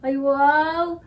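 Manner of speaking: loud, drawn-out wow; impressed amazement. Acted. Student hears Kuya Pepe does fencing, impressed amazement. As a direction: react with delighted amazement.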